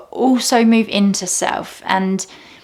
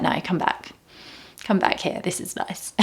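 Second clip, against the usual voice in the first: whisper; 8 dB quieter than usual. Pretend. Calling wandering mind back.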